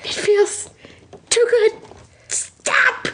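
halting, very high plea; puffed protest. A narrator's reading. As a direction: break it into overwhelmed little bursts. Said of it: "he puffed".

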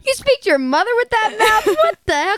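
high-pitched imitation of a dad telling off; mock outrage of a scolding dad; friend's scolding dad. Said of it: "And then her dad".

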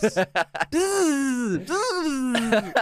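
very high buzzing noise; imitated buzz. Mimicking the movie buzz for his co-host, very high. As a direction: buzz like an overexcited radio host.

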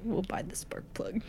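unvoiced aside whispered to the group. Real, not acted. Player whispering a plan to her party. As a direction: hush it so the clerk can't hear.

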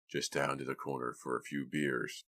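curt, tired dad; gruff father. A narrator's reading. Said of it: "Mister Wakely replied".

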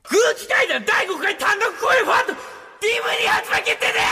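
high, garbled screaming announcement; over-the-top shouted announcement. Pretend. Performer redoing the DVD announcement at full volume, frantic screaming. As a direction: scream the news until it is barely intelligible. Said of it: "もっと大声で言わなきゃいけないんだ".

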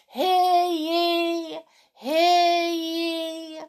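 slow, high proclaiming cry; town crier. Pretend. Town crier call after topic.

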